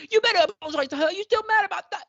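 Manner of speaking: high, loud put-on British accent; mock British accent. Imitating the actor's slipping British accent, high and loud. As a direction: slip into a hammed-up British voice. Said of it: "multiple sentences like".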